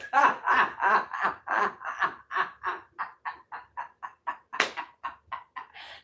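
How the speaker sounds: big laugh tailing away breathlessly; long laugh. Real, not acted. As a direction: crack up and keep laughing until it fades. Laughs at own dog joke.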